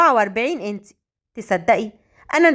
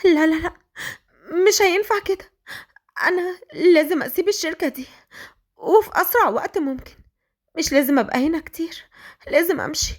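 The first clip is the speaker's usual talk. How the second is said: upset, tearful; 7 semitones higher than usual. A narrator's reading. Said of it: "وهي بتعيط".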